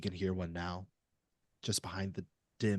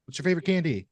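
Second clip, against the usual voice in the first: calling out; 8 semitones higher, 8 dB louder than usual. Acted. Everett calls over the shelves.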